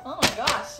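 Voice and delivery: usual speaking voice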